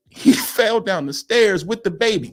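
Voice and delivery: usual speaking voice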